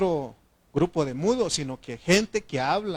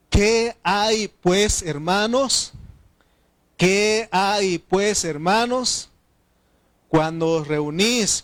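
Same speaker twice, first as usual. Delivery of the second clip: loud, slow, higher scripture proclamation; a pastor booming out Corinthians; preaching the verse; 5 semitones higher, 8 dB louder, slower than usual. Acted. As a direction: proclaim each gift slowly to the church. Reads scripture at full force.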